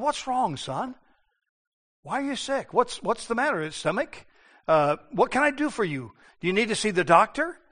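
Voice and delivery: soft, hushed worried father; a father gently asking his sick son; tender fatherly concern. Retold or imitated. Father softly asking sick son what's wrong, tender and hushed. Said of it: "he must have said to Amnon".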